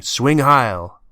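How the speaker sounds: shouting. A narrator's reading. Willie runs after the truck, shouting. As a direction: shout the salute.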